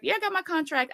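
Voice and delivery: put-on high voice for a coworker; imitated colleague. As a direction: lightly parody an upbeat reply from a colleague. Quoting colleagues chatting about contracts.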